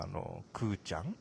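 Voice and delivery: usual speaking voice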